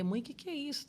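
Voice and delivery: imitando a filha pequena, mais aguda; voz fininha de menina perguntando; voz de criança. Retold or imitated. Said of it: "Ela pergunta o que que é". (criança curiosa perguntando pra mãe)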